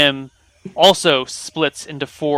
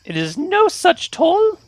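high, slow squeaky fey voice; high little fey begging for treats; tiny brownie voice; slower than usual. Acted. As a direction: ask sweetly in a tiny creature squeak.

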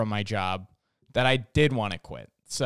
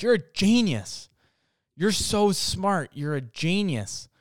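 slow, drawn-out imitated praise, pitched up; lightly mimicked fawning; mock admiration; 7 semitones higher, slower than usual. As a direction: gush as if awestruck, lightly hammed up.

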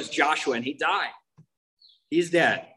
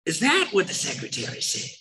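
exaggerated, higher voice of a sponsor; imitating his sponsor; 9 semitones higher than usual. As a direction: impersonate an intense sponsor, pitched up. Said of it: "That's how he talked, right?".

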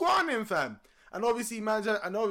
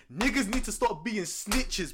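usual speaking voice

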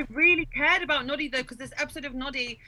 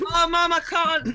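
put-on louder, pleading child's cry; retold child's wail; 5 semitones higher, 7 dB louder than usual. Scared child begging her mum during Noddy, louder and pleading. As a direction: beg Mum like a frightened kid. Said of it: "then I'd be screaming, crying".

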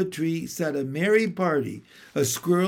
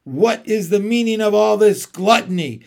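louder, scornful queen's demand; evil queen; 3 semitones higher, 6 dB louder than usual. A narrator's reading. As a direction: hurl the question at them. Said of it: "she said again".

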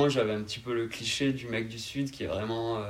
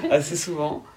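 laughing; 7 semitones higher, 7 dB louder than usual. Real, not acted. Laughing while answering about mockery.

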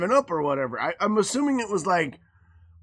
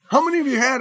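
sharp mom; 8 semitones higher, 6 dB louder, much faster than usual. Retold or imitated. Mom demanding.

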